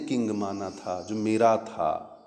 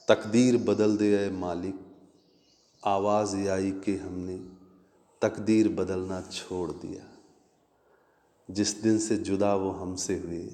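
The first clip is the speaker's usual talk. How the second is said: ghazal recitation; 4 semitones lower than usual. Acted.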